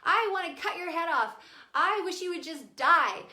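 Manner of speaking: loud, high mock tantrum; hurling insults. Tantruming daughter insults her mother, mock furious yell. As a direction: imitate a furious child yelling at mom. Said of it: "she started with all the insults".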